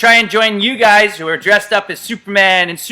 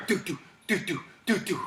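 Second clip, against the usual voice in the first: much softer, steady doo doo; heartbeat sound; 13 dB quieter than usual. Acted. Coach demonstrating the doctor command: soft mock heartbeat. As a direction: tap out a quiet heartbeat.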